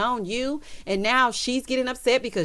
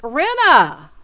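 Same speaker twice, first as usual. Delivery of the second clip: calling out; 5 semitones higher, 6 dB louder than usual. Acted. Calling out to a cast member.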